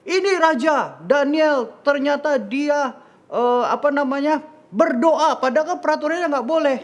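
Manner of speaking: very high, loud tattling voice; shrieky-high acted accusation before the throne; accuser reporting to the king. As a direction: denounce the prisoner loudly in a high put-on voice. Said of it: "dihadapkan kepada raja".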